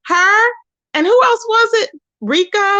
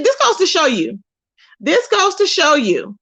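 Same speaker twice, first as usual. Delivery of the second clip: ordinary talk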